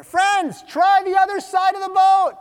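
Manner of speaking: high, loud shout; yelled instruction. Acted. Jesus tells fishermen where to cast, yelling. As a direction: call it out across the water. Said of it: "Jesus yells".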